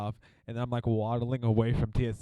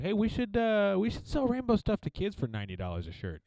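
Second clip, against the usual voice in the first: mock pitch meeting; 9 semitones higher than usual. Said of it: "So we're like".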